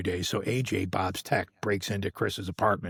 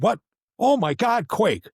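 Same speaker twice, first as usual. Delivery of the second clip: high, loud cry of delighted recognition; astonished greeting; 13 semitones higher, 6 dB louder, slower than usual. Retold or imitated. Stage manager turns and spots an old colleague, astonished greeting. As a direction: act out a thrilled double-take, pitch leaping up.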